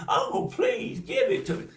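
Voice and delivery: very high pleading; begging girl. Acted. Niece begging her uncle again, very high and pleading. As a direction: beg sweetly, last try.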